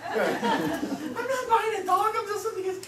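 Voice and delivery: loud, high, laughing insistence; high-pitched protest. Retold or imitated. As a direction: protest too much, voice pushed up high. Retold protest against buying dog.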